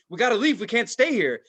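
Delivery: high, loud frightened urgency; mock panic. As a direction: panic to get out. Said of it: "I was like".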